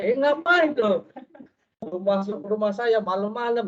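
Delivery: mock indignant husband; husband confronting the preacher in his house at night, hammed-up angry.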